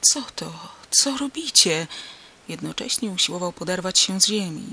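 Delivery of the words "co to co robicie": higher-pitched startled call; startled cry. A narrator's reading. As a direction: cry out on coming to. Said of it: "oprzytomniał i zawołał".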